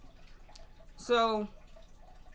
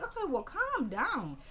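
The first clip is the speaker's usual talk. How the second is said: higher, slow mock calming; exasperated retort; 7 semitones higher, 3 dB quieter, slower than usual. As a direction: drag out an exasperated calm-down. Her acted reply to bossy cop.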